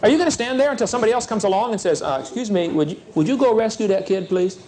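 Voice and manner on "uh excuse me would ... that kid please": a hesitant, overly polite bystander asking a favour; timid bystander. Acted. Bystander at the bus wreck asks someone else to help, timid and polite. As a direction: hem and haw politely.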